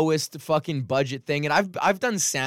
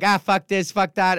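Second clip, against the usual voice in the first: louder, higher imitation of a swearing comic; mock swearing outburst; 6 semitones higher, 5 dB louder than usual. Retelling Sandy's swearing at a clean gig, mock outburst. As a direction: fire off curses as another comic. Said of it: "he starts swearing".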